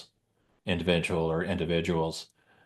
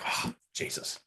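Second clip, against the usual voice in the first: breathy whispered oh Jesus; shocked whisper; 9 dB quieter than usual. Retold or imitated. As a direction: hush it out in shock. Shocked by mother's death.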